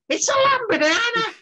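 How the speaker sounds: retold Welsh stranger shouting out, high and excited; imitated Welsh voice. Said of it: "this voice came from behind me".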